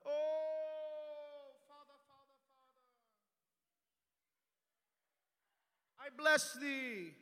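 hammed-up pious wail to God, high and loud; showy prayer voice. Pastor mocking hypocrites praying loudly, hammed-up pious wail. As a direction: overdo a showy public prayer. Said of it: "you're out here praying to God".

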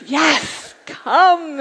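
warm yes through a laugh; laughing invitation. Retold or imitated. Karate teacher inviting her to church, laughing. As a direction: laugh it out, welcoming.

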